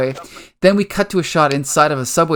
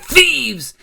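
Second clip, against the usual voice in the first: outraged cry, much higher; angry shout; 10 semitones higher than usual. Retold or imitated. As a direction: spit the word out in outrage. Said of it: "he's pissed off".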